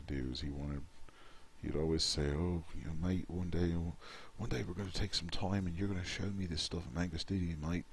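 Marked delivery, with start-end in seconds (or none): 2.3-7.8 a lightly put-on British accent, imitating a friend; bad British accent. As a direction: mimic your mate Tony in a clumsy British accent. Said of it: "I do the worst British accent ever".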